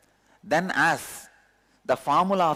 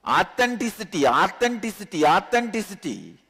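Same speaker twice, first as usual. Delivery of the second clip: fervent repetition; 6 semitones higher than usual. Real, not acted. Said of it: "vibrating in my heart".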